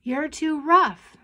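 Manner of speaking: high, slow, loud whine; drawn-out childish accusation; complaining child. A narrator's reading. Twin squabbling with her sister, complaining.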